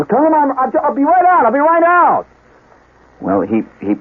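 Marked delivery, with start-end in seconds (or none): 0.0-2.2 high frantic holler through the door; harried yell back. Retold or imitated. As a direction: shout the promise, imitating a panicked dad. Dad yells through the door.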